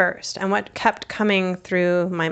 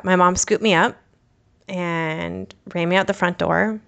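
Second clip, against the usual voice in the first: ordinary talk, nothing marked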